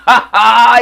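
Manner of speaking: loud high laugh; hearty laugh. Real, not acted. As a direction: burst out laughing. Laughs at own catchphrase.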